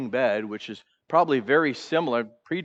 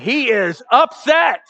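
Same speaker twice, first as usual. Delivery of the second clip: higher, louder, slow stressed upset; emphatic; 8 semitones higher, 6 dB louder, slower than usual. Real, not acted. Preacher stressing the king's mood, emphatic and loud. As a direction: hammer home how upset he is. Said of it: "I mean".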